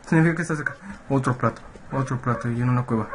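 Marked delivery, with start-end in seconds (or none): none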